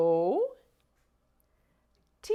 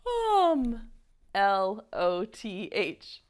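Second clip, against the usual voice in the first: big fake yawn before L O T H; yawn replacing S; 4 semitones lower than usual. Shown as a demonstration. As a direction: yawn the first letter, then spell normally. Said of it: "we're gonna say".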